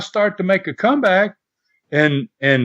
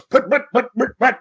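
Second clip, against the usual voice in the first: higher-pitched putt-putt of a dying motor; sputtering engine noise; 6 semitones higher than usual. Retold or imitated. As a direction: imitate a motorbike running out of gas. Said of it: "and then he goes".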